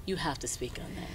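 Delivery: quick unvoiced whisper aside. Real, not acted. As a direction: whisper it quickly to your co-star. Urging co-star to answer.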